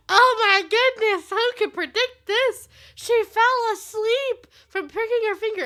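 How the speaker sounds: high, loud, drawn-out disbelief; over-the-top pretend outrage, slow and loud; mock shock. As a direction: wail it with hammed-up astonishment. Acting out shocked onlookers.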